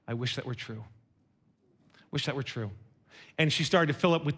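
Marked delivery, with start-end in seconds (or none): 0.1-2.7 quiet tearful reply. Retold or imitated. Waitress answers through tears.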